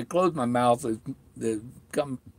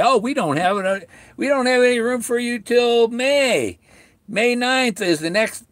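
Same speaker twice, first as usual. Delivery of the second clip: high, put-on receptionist voice, chirpy and dismissive; mocking the receptionist; 9 semitones higher than usual. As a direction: parody the dental office brushing him off. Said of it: "and we're gonna say".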